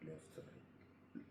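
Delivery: hushed self-instruction; whispered to himself. Retold or imitated. To himself, choosing which way to look: a whisper. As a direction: whisper left, right under the breath.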